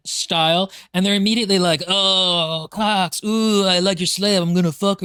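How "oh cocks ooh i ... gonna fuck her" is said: hammed-up leering British pirate; British pirate accent. Slavers leering at the slave, in a British pirate accent. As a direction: do a crude British pirate of the Caribbean. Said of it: "just like British Pirates of the Caribbean".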